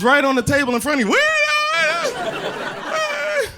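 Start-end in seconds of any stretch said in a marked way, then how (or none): 1.1-3.5 put-on very high, puzzled child's question; confused little kid. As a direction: squeak it like a lost kid. Son can't see remote.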